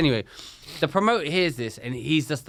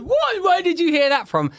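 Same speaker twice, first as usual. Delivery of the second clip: very high-pitched mock outrage; imitated high, indignant protest; indignant squawk; 12 semitones higher, 3 dB louder than usual. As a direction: squeal it like an affronted promoter. Imitating outraged promoter confronted.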